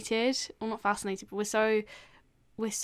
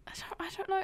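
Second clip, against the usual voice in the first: whisper; 9 semitones higher, 7 dB quieter, faster than usual. Real, not acted.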